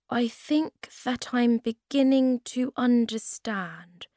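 thinking it out aloud; slow realisation. A narrator's reading. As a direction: let the understanding arrive gradually. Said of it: "said Moomin Troll slowly".

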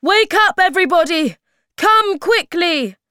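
high, very loud wake-up call; urgent shouting. A narrator's reading. Moomin Papa waking everybody, urgent high shout. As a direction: rouse everyone with a big shout. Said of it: "shouted Moomin Papa".

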